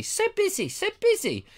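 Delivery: a hammed-up overwhelmed sigh; mock fluster. Mock exasperated repetition.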